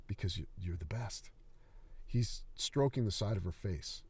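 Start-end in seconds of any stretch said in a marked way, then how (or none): 0.1-1.3 softer, lower, hesitant; frightened stammer. Acted. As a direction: stammer it out scared.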